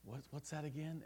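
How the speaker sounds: put-on softly kneeling beside a struggling child; soft pleading question. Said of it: "we're down on our knees".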